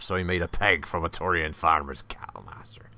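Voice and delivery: lightly voiced pirate growl; hint of a pirate "arr". Pirate voice joke.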